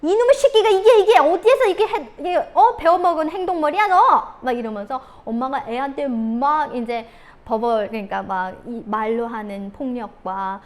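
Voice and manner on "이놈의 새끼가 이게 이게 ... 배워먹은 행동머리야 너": loud, high screaming scold; mother screaming at her kid over the mess; furious mom yelling. Retold or imitated. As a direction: lose it completely at the child. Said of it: "뚜껑이 확 열리는 그 순간 엄마가".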